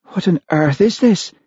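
high-pitched, indignant question; appalled demand. A narrator's reading. Olivia after her first mouthful, appalled demand. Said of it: "she demanded".

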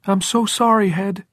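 put-on high, loud, grief-stricken boy; anguished child's wail in words; breaking treble cry. A narrator's reading. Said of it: "Jordan cried in a breaking treble voice".

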